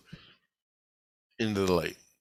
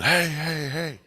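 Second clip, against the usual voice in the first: calling out hey; 7 semitones higher, slower than usual. Retold or imitated. Banging on a door in space, yelling to be heard. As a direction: yell hey to be let in, pitched up. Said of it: "And I'm screaming".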